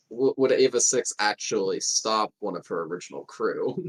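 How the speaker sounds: ordinary talk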